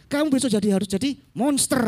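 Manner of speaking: high, loud mock parental order, rolling the R of "monster"; parent ordering naughty child. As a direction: lay down the law to a naughty child. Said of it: "anak yang keempat ini bandel". (orang tua memerintah anak bandel, nada tinggi)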